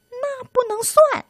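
very high squeaky protest; little mouse voice. Acted. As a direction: object in a tiny high voice. Mouse objects.

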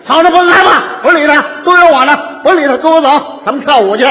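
hammed-up hero sweeping her away; swaggering protector. Rowdy mediator brashly leads the wife off, loud. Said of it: "他觉着怪不错了".

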